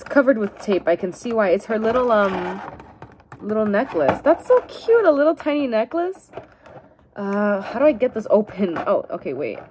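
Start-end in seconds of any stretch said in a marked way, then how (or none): 4.2-6.3 gushing. Real, not acted. Gushing over tiny necklace.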